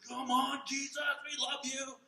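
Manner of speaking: loud, high ecstatic worship cry; worship shout. Retold or imitated. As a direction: belt it out like a crowd at full worship. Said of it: "and we're all like".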